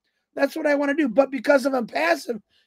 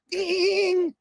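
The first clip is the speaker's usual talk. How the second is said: high mock alarm ding; ding it out like an alarm clock; imitated alarm clock bell; 8 semitones higher than usual.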